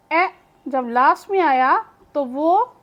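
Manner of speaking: ordinary delivery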